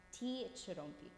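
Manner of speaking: Ti, ch rompi said slowly, ch apart; slow syllable-by-syllable Ti, ch rompi; slow sounding out. Shown as a demonstration. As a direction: pace out Ti, ch rompi. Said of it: "ch is such a strong letter".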